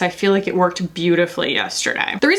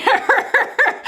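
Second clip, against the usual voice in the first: high giggle; 13 semitones higher than usual. Real, not acted. Laughing at her bad colour.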